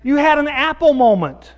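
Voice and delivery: high, loud self-rebuke; scolding himself. Retold or imitated. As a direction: call yourself a dummy, pointedly. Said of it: "And I say".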